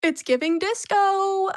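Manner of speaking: loud, high, drawn-out cheer; excited exclamation. Real, not acted. Crafter showing off a disco look, excited exclamation. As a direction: belt it out slowly with delight. Delighted reaction to glitter craft.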